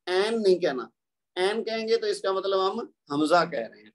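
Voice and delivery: ordinary talk, nothing marked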